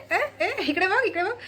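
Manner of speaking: calling the bird. Real, not acted.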